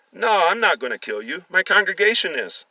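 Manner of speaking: high, loud sinister glee; gloating reveal. Acted. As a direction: relish the twist to the captives. Villain reveals the congregation.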